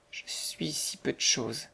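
soft, slow, whispered voice; humble, despairing young woman. A narrator's reading. Poor girl confiding in Mistress Jones, despairing and hushed. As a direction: whisper it slowly, helplessly. Mary's voice in narration.

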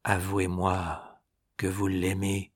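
murmurez, lent et très doux; à voix très basse, presque dans un souffle; murmure très bas. A narrator's reading. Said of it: "elle murmura à voix très basse". (murmure très bas et lent)

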